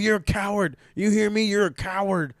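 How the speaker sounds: high-pitched imitation of a wrestler's outrage; mock freak-out. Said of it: "Roddy's freaking out".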